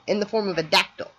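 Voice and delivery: ordinary talk, nothing marked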